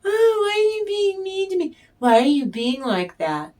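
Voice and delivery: high, fast mock whine; whiny victim. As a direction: play the wounded manipulator whining. Mocking the whiny toxic person.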